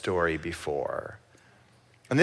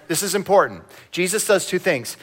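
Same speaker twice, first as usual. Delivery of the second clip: ordinary delivery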